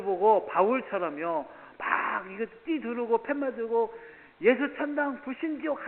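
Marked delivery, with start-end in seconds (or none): 4.4-5.9 mock street slogan.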